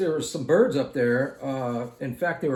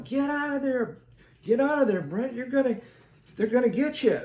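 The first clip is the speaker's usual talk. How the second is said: retold yelling warning; 10 semitones higher than usual. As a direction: call him away from the tree in alarm, voice up high. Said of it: "He's yelling".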